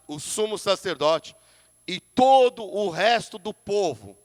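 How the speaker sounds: slow, solemn, weighty Bible reading; scripture reading. Acted. Pastor reads Haggai to the congregation, slow and solemn. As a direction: read each name slowly from the pulpit.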